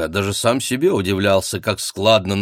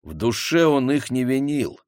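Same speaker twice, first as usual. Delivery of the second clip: ordinary delivery, nothing marked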